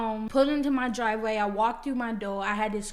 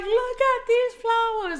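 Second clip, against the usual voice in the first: high, squealing best friend; excited squeal; 13 semitones higher, 5 dB louder than usual. Retold or imitated. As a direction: shriek it with delight at the roses. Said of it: "she's like".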